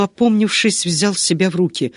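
ordinary delivery, nothing marked

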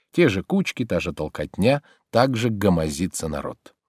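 ordinary delivery

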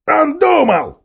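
high, loud mocking echo; high loud mockery; mocking mimicry. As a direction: mimic him with scorn. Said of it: "передразнил Хитр".

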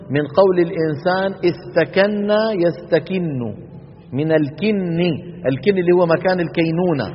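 ordinary talk

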